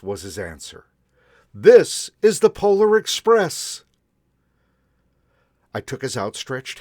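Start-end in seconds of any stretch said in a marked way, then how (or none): 1.5-3.8 loud, high, hearty conductor; booming conductor. A narrator's reading. Conductor telling the boy the destination, loud and hearty. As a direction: announce it proudly like a conductor.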